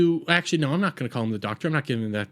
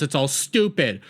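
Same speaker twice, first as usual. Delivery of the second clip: normal talking voice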